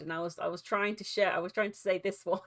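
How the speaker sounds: ordinary delivery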